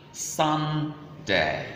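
"Sunday" split as "Sun... day"; syllable by syllable. Shown as a demonstration. Teacher breaking the word down for learners, slow split syllables. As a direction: hold "Sun" long and level, then "day". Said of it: "how to pronounce days of the week".

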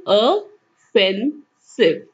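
ऑफेंसिव drawn out very slowly, uh-FEN-siv; slow model. Shown as a demonstration. Teacher modelling the word very slowly. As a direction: stretch ऑफेंसिव syllable by syllable. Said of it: "क्या है इसकी pronunciation?".

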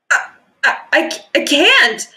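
stammering. Acted. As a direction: stumble over the words in panic. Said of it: "the Rainbow Fish started to say".

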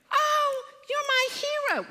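very high, loud adoring squeal; gushing cashier. Retold or imitated. Cashier greeting the daughter, high loud adoring gush. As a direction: gush over her like a star. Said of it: "And she was like".

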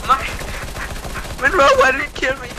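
high, loud outraged cry; outraged yell. Real, not acted. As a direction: yell it at the teammate. Teammate killed him.

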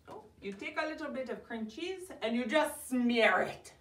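ordinary talk

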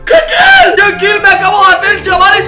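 high, loud victory yells; ecstatic shout. Real, not acted. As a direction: scream with joy at the kill. Celebrating a great kill. (gritos de euforia)

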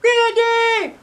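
put-on very high, loud girl's cry; shouted. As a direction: call out ready with gusto. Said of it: "Alexia shouted".

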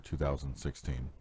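ordinary delivery, nothing marked

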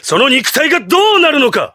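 intense threat. Acted. Villain warning his foe of meeting another self, intense and urgent. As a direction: press the threat urgently, pitched high.

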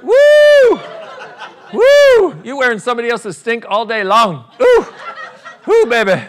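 high, loud drawn-out "woo!"; stink reaction whoop. Acted. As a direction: whoop as if hit by a stench. Mock reaction to body odour.

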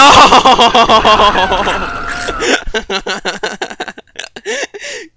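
hysterical burst of laughter; loud shocked laugh. Real, not acted. Jump scare in horror game.

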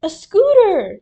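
high, very loud delighted yell; thrilled high exclamation; excited shout. A narrator's reading. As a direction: burst out at opening a gift. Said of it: "he shouted".

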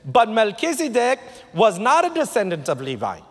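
high, emphatic pulpit declamation; ringing Bible reading, pitched well up; preached scripture reading. Acted.

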